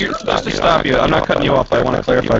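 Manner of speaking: glitchy robotic mic voice. Real, not acted. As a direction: sounds like a broken robot mic. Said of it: "Robot voice".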